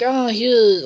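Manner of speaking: high, loud motherly voice. Retold or imitated. Pickles the mother hands him bags, in a high loud voice. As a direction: pop it up high like a fussing mom. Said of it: "is like".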